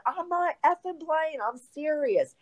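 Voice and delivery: high, strained holler. Retold or imitated. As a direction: yell it down the phone so he finally believes you. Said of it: "literally I had to holler".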